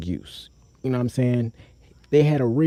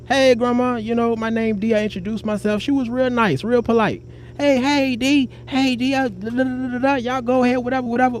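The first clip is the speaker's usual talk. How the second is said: high retold greeting; 9 semitones higher than usual.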